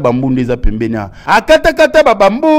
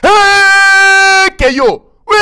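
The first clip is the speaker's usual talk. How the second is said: excited shout; 6 dB louder, much slower than usual. Real, not acted. Loud exclamation in report.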